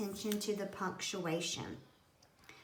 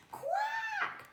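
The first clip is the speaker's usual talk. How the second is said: very high quacking duck; a squeaky duck's quack. Acted. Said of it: "the duck moans".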